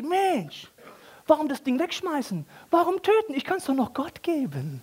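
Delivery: high, sly shepherd voice; scheming shepherd. Acted. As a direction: sell the stingy idea to yourself. Voicing the shepherd's cheap idea. (hohe, schlitzohrige Hirtenstimme)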